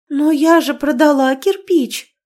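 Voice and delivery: high, loud mock astonishment; feigned surprise. A narrator's reading. Niece to uncle, feigned bewildered surprise. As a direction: play innocent surprise. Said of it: "деланно удивилась с недоумением".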